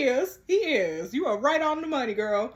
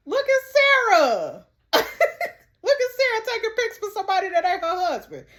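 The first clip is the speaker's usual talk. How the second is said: laughing tease; 6 semitones higher, 4 dB louder than usual. Real, not acted. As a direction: call Sarah out while cracking up. Teasing the character Sarah.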